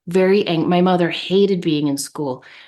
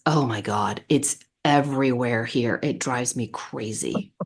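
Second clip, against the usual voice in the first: eye-rolling exasperation; 6 semitones lower, 5 dB quieter than usual. Retold or imitated. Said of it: "she rolled her eyes and goes".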